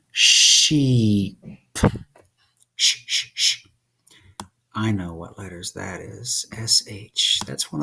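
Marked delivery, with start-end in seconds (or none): 0.1-1.4 "Sheep" stretched and louder, falling; over-articulate "Sheep" so they see your mouth; said slowly. Shown as a demonstration. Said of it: "Say it slowly. Watch my mouth". 2.8-3.7 unvoiced "sh" hushed three times; sounding out sh. Shown as a demonstration. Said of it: "sounds you have to know how to make".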